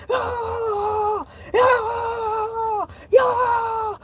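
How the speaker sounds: long high-pitched "ah" wail; hysterical scream. Acted. Shark losing control while retelling, screaming. Breakdown mid-story.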